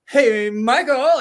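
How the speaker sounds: mock slow, loud showy hello; drawn-out greeting. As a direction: stretch the greeting out to show off. Greeting him before the mohawk reveal.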